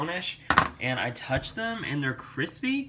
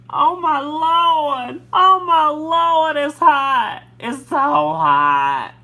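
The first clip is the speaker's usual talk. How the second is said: burning scream; 12 semitones higher, 12 dB louder, much slower than usual. Acted. Kale screaming from heat.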